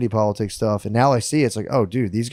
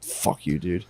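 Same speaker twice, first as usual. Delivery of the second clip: mock dismissive jeer; 9 semitones lower, 8 dB quieter, slower than usual. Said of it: "they were just like".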